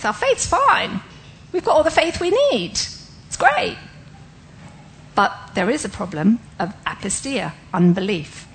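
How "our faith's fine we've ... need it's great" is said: high, lilting put-on voice; mock breezy assurance. Preacher reassuring the congregation their faith is fine, mock-breezy high voice. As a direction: pitch it way up, climbing to a squeaky "great".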